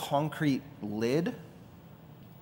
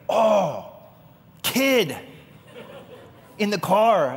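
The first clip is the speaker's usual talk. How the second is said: high, loud gasp of realization; mock panicked realization, half laughing; sudden panic; 8 semitones higher, 6 dB louder, much slower than usual. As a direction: jolt of horrified memory. Realizing child left in car.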